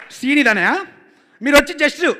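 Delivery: emphatic scene briefing. Retold or imitated. Director explaining the scene to the star, loud and eager. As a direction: pitch the scene forcefully to the star.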